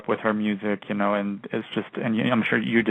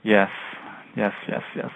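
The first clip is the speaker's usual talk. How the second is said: whispered; 3 dB quieter than usual. Real, not acted. Excited whispered yeses.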